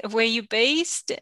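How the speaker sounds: ordinary talk, nothing marked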